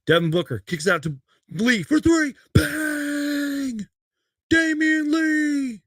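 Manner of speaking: big, high-pitched play-by-play yell, stretched out slowly; roaring broadcast-style call of a clutch three; excited announcer call. Acted. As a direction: call the shot like a TV announcer going wild. Calling the replayed game-winning three.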